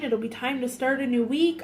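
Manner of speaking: usual speaking voice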